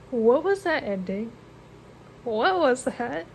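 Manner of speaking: reeling after a shocking final chapter; stunned disbelief. Real, not acted. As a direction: ask it like you can't believe what you just read. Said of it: "my reaction to the ending".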